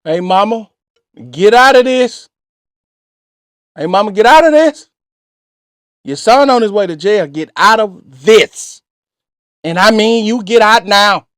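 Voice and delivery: loud, firm telling-off of the mother; mock scolding. Host tells the boyfriend's mother to stay out, loud scolding. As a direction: order the mama out of it, half joking.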